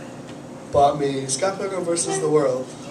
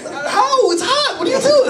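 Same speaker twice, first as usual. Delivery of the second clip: high, laughing disbelief; baffled and pitched way up; can't believe they drink it hot; incredulous. Real, not acted.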